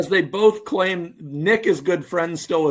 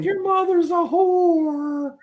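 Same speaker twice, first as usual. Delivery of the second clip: much higher, slow drawn-out promo voice; Terry Funk catchphrase; 13 semitones higher, much slower than usual. Retold or imitated. Friend mimicking Terry Funk's catchphrase, high and slow. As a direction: stretch it out like the old wrestler. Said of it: "if he's not responding".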